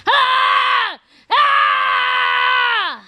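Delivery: high, loud sustained yell; long shouted ah; loud yell on request. Pretend. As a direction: scream when asked, full voice. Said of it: "Can you start yelling?".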